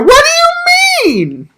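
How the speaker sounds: very high, slow, whiny squeal; mock whiny tall guy. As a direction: stretch it out in a silly high squeak. Mocking tall dudes' complaint.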